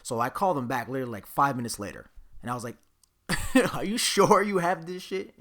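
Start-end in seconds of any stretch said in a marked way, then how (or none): 3.3-5.3 laughing disbelief. Retold or imitated. Calling store back, laughing.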